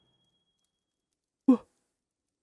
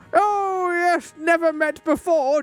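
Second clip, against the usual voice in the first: sarcastic; 8 semitones higher, slower than usual. Acted. Sarcastic voiced NPC reply.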